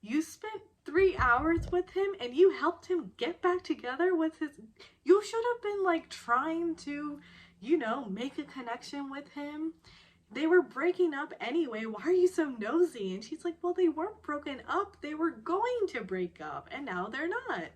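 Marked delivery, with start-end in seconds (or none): 0.0-13.1 indignant scolding, high and loud; angry. Retold or imitated. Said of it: "gets so angry at this". 13.6-17.6 high, emphatic comeback; defensive retort. Retold or imitated. Acted retort in retold quarrel.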